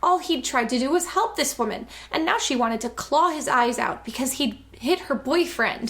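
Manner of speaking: normal talking voice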